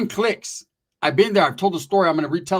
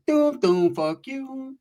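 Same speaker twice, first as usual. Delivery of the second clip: mock phone voice; 9 semitones higher, much slower than usual. His dying phone shutting down, a mock sing-song sign-off. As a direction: mimic the phone signing off. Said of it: "it went".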